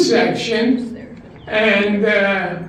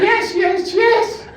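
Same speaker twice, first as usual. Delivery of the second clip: high, climbing, gushing yeses; giddy yes; 11 semitones higher, slower than usual. Acted. Old woman answering the phone call, giddy and high. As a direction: gush it out higher and higher.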